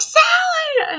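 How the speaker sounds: very high, loud call for help; imitated frantic scream of a name. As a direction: scream the name as if summoning someone urgently. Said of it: "So she's screaming".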